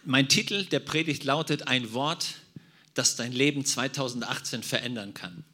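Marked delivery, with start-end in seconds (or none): none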